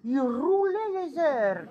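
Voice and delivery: "Il roulait les heures" slow, with the R of "roulait" rolled hard; a man's rolled R, imitated slowly. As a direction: roll the R in "roulait" and draw out "heures". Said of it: "Il parlait comme ça, il roulait".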